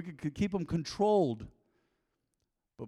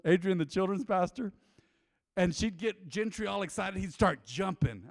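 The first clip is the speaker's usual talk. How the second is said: ordinary talk, nothing marked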